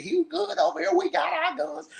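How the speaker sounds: squeaky high mocking voice. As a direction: mimic her excuse in a high voice. Mocking someone's excuse.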